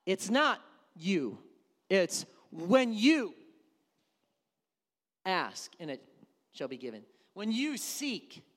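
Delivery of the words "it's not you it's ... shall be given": usual speaking voice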